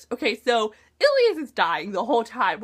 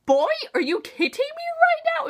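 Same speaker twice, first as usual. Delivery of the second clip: much higher, exasperated "are you kidding me"; mock outrage. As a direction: squeal it in disbelief at the interruption. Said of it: "and I was like".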